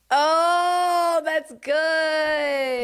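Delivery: long, high drawn-out cheer; delighted approval. Real, not acted. Host hearing the duo shirt slogan, high delighted whoop.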